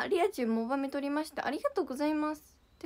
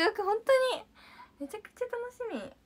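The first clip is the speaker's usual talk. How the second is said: excited; 10 semitones higher, 4 dB louder than usual. Real, not acted. Excited about the Osaka tour.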